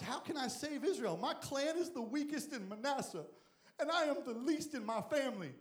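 high, whiny weakling voice; mock-feeble Gideon pleading; timid Gideon. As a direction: squeak it like a scrawny nobody.

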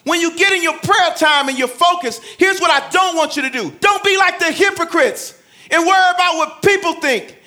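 loud, high, driving preacher; full-force preaching. Acted. Pastor urging the church to pray in private, loud and high. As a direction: preach it out to the congregation.

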